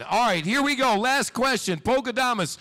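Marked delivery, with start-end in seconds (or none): none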